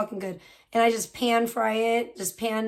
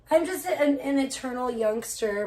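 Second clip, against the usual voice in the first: ordinary delivery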